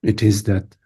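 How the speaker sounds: normal talking voice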